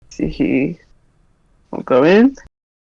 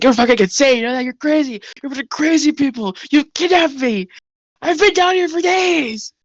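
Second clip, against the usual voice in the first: higher, ranting captive voice; higher-voiced captive spitting out insults; angry captive; 8 semitones higher than usual. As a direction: rant at your kidnappers.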